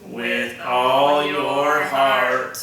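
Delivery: very slow, mechanical alien drone; robot alien voice. Pretend. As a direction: chant it like a robot from space. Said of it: "how about we do it in a robot alien voice".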